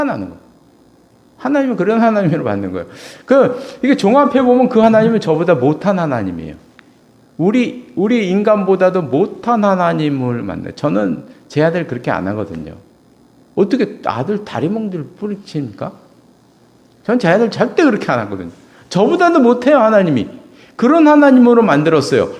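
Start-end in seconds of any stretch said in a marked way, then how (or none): 3.3-10.7 preaching louder and higher; rising preaching. Acted. Preacher building his case before the congregation, louder. 17.0-22.3 loud, high preaching cry; full-force preaching. Acted. Preacher crying out to his congregation, loud and high.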